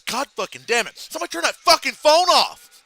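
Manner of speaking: mock rage building to a loud yell; angry Bernie. Imitating Bernie when a phone rings mid-podcast, exasperated fury. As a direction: start irritated, end high and shouting. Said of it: "And Bernie goes".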